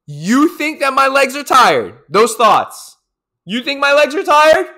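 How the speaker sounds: defiant. Retold or imitated. Runner defying his mind mid-race, loud and high. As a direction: fire back at your own doubts. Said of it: "And I said".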